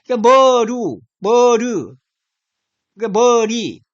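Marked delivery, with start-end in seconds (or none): 0.3-2.0 "머루", then "머르", first syllable held long and loud; pronunciation demo. Shown as a demonstration. Teacher demonstrating a sound shift to linguists, drawn-out pronunciation demo. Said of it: "발음할 수 있죠". 3.2-3.8 "머리" with a long first syllable, ending in 리; pronunciation demo. Shown as a demonstration. Teacher showing where the sound shift ends, drawn-out pronunciation demo. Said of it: "발음할 수 있죠".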